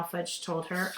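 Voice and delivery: ordinary delivery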